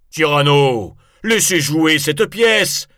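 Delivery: loud, high shouted demand; crowd protest. Acted. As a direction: call out a complaint from the audience. Spectators protest from the hall.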